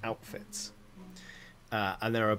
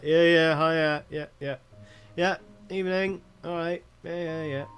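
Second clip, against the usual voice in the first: higher, louder, offhand yeahs; non-committal replies; 7 semitones higher, 7 dB louder than usual. Acted. Berts passing each other, tossing off non-committal greetings. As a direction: brush off the greetings without interest. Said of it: "non-committal replies being like".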